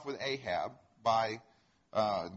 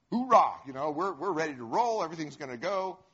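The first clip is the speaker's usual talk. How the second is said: high, loud hoorah easing into eager assurance; gung-ho cheering; 3 semitones higher, 5 dB louder than usual. Retold or imitated. Prophets assuring the king they are ready, gung-ho cheering. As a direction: play eager yes-men rallying the king.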